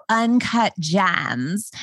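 slow drawled New York vocal fry; imitating the meme. Quoting the TikTok meme in a slow New York drawl. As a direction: drag out each word, lazy and deadpan. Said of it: "if you're seeing people go".

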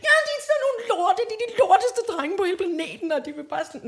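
put-on high, fast child voice fading out; teacher voicing the girl ranting about the boys; upset little girl. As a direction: rattle off complaints like a little kid. Girl quoted.

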